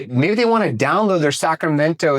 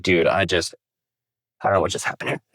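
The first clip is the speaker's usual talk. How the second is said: low, quieter, drained mock bafflement; deflated bewilderment; 7 semitones lower, 3 dB quieter than usual. Coach acting out someone leaving a bad meeting, deflated and baffled. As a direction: drop low and soft, as if worn out.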